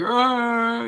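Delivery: a playful imitated bear roar; bear growl imitation. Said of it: "He sounds like, you know, the bear".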